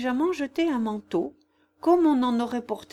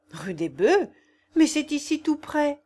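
flustered, eager cry, higher and louder; agitated exclaim; 6 semitones higher, 5 dB louder than usual. A narrator's reading. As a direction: blurt it out in a fluster. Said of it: "m'écriai-je en proie à une agitation extraordinaire".

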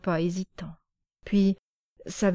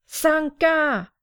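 much higher and louder call from afar; 9 semitones higher, 4 dB louder than usual. Acted. Mother calling Sanka home from the house, high and loud. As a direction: call the child home across the yard. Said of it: "appela une voix".

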